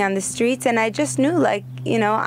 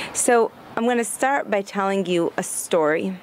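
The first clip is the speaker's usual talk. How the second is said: usual speaking voice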